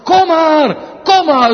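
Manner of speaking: loud, inviting call from afar. Acted. Jesus calls the wretched sinners from his throne, loud and inviting. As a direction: call them in warmly but loudly. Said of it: "zo roept hij vanaf die troon".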